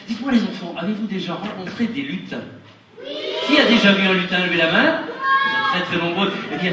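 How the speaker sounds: put-on lively storytelling to children; kids show host. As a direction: spin a tale for the kids.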